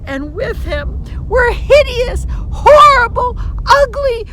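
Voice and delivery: loud, high, savoring each ugly word; relished monster list. A narrator's reading. Storyteller gleefully describing the hideous monsters. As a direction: linger on grotesque with gusto.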